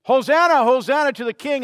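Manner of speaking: loud, high, jubilant hosanna; crowd's joyful cry. Retold or imitated. The Jerusalem crowd hails the coming king, loud and jubilant. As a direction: call it out like a cheering crowd. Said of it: "as the people sang".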